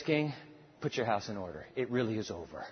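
low, soft, solemn word to the dying king; hushed grave message. Acted. Prophet softly telling the dying king his end. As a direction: break the news gently and quietly.

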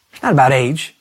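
ordinary talk

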